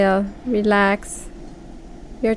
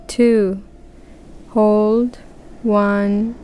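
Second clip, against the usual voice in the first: unhurried counting with pauses; slow breath counting; much slower than usual. Shown as a demonstration. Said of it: "We will start breathing to a count".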